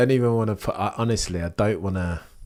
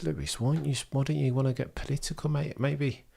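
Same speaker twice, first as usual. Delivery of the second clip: lightly voiced younger self, pitched higher and quick; a hint of a chummy nudge, higher and quicker; past self urging Lewis; 6 semitones higher, 5 dB quieter, faster than usual. Streamer mimics his old self nudging Lewis, higher and quicker. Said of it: "I would have said".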